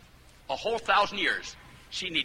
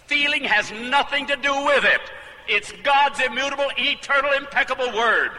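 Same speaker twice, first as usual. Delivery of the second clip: high, loud, full-force proclamation; emphatic preaching; 10 semitones higher, 8 dB louder than usual. Real, not acted. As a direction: hammer home each word to the congregation.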